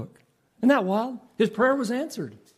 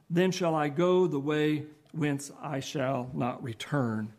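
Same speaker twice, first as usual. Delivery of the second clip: scripture reading; 3 semitones lower, 3 dB quieter than usual. Acted. Preacher reading Job to the congregation, solemn. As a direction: read the verses like holy words.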